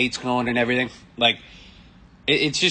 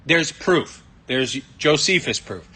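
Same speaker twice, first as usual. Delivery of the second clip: ordinary delivery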